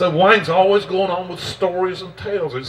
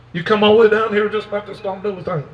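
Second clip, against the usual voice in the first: higher-pitched incredulous retold question; mock disbelief, pitched up; 6 semitones higher, 3 dB louder than usual. Teller acts out the storyteller's astonished question.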